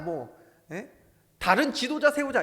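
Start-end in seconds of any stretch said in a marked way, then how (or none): 1.4-2.4 loud, raised call of a mutinous crowd; rebellious crowd cry. Retold or imitated. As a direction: call out like rebels rallying the congregation. Voices the rebelling congregation.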